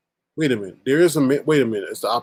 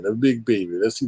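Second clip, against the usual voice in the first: ordinary talk, nothing marked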